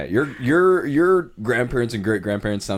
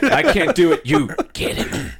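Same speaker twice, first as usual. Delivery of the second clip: put-on frail old lady; 8 semitones higher, 4 dB louder than usual. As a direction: hand off the beating like a feeble granny. Frail great-grandma delegating beating.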